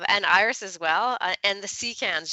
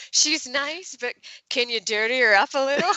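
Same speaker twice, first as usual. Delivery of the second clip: higher, lightly sing-song request; mock polite feedback; 7 semitones higher than usual. Author mimicking her own note to the cover artist, mock polite. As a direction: sweeten the ask like you're embarrassed to make it. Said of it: "which was like".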